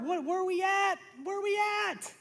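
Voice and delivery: high, strained, pleading voice; distressed pastor, voice far up; anguished plea. Real, not acted. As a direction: cry it out high in disbelief. Pastor distraught over bathroom.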